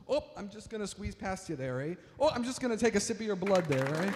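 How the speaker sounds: put-on mild-mannered Midwestern vampire accent. Midwestern vampire excusing himself past someone, in a Midwestern accent. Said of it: "imagine a sort of Midwestern accent, a Midwestern vampire".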